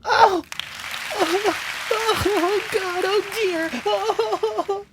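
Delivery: high startled gasping oh's; mock-scared wife after husband yells at her; frightened cries. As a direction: jump back shaken by the yell. Wife reacts to the scream.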